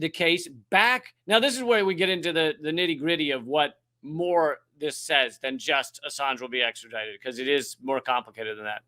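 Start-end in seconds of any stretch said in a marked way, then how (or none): none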